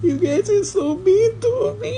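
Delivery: high, slow whiny sob; fake-wail at being teased; mock crying. Teased friend whining to the group, mock crying.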